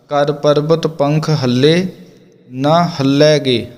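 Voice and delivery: ਹੱਲੇ and ਹੱਲੈਗੇ with a tight held ਲ, slow; tight doubled l. Shown as a demonstration. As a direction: press the ਲ hard in ਹੱਲੇ and ਹੱਲੈਗੇ. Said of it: "ਇਸ ਨੂੰ ਕੱਸ ਕੇ ਬੋਲਣਾ". (ਹੱਲੇ ਕੱਸ ਕੇ, ਲ ਦੀ ਦੁੱਤ ਨਾਲ)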